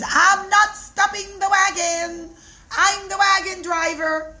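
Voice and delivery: stubborn refusal. Acted. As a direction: plant your feet and refuse. Driver refuses again.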